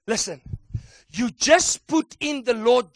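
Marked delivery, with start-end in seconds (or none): none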